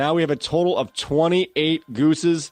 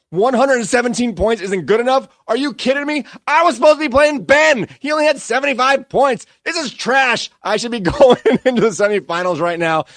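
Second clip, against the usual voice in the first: higher and louder furious rant; full Hulk rant; 6 semitones higher, 4 dB louder than usual. Acted. Fantasy player ranting at his loss, loud and furious. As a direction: yell it out like a fantasy loser losing it. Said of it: "I'm in a full Hulk rant".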